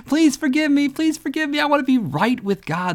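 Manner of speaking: put-on high, begging teen voice; mock desperate pleading. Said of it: "I'd plead and beg".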